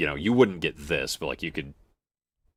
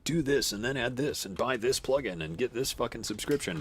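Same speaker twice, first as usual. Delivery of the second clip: YouTube tutorial voice; 6 semitones higher, 6 dB quieter than usual. Retold or imitated. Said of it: "something of, like".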